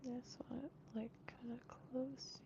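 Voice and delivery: soft whisper while concentrating. Real, not acted. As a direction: whisper it, focused on the task. Murmuring while working.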